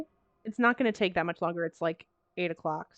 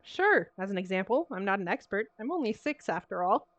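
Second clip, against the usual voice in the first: ordinary talk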